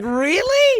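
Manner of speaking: high, loud disbelieving really; reply in mock disbelief, pitch soaring; incredulous. Cohost retelling his doubt about the accent, high incredulous. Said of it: "And I'm like".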